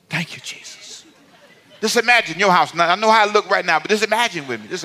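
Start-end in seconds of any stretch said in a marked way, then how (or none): 0.1-1.0 hushed, breath-only prayer of relief; playfully awed whisper; whispered thanks. Pretend. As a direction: whisper it as if dreaming of a clean house. Acted relief at imagined clean house.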